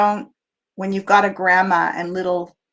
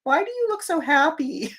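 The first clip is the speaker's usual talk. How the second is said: higher, hammed-up astonishment; disbelieving sleeper, higher; mock puzzled; 8 semitones higher than usual. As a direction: act baffled by someone's calm. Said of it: "they're like".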